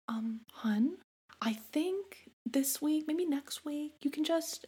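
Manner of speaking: hushed, halting, high imitation of a careful partner; tiptoeing boyfriend. Boyfriend gently warning his upset girlfriend, hushed and careful. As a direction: tread carefully, half-whispered and hesitant.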